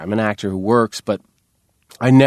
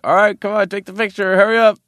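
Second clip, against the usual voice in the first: hoger en luider, ongeduldig opjagen; impatient urging; 11 semitones higher, 5 dB louder than usual. Retold or imitated. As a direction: hurry the dawdling kid along, loud and pushy. Urging the slow kid photographer.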